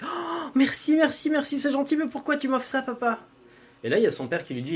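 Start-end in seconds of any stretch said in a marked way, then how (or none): 0.0-3.2 put-on high, bright girlish voice pitched way up; young girl Anaïs. Girl thanking her father for a gift, high girlish voice. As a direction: play the surprised young daughter, voice lifted high.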